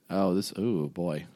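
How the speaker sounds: usual speaking voice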